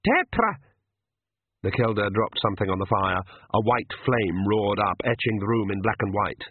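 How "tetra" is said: pixie shout. A narrator's reading. Pixies counting off the shot.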